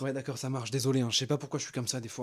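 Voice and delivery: low, very soft mumble; sheepish apology. Acted. Calmed kid apologising to animator, sheepish. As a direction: apologise quietly, subdued.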